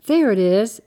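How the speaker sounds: loud, excited call. A narrator's reading. Girl spotting the street from the pickup, excited. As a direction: point it out with a burst of excitement. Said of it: "Annie said excitedly".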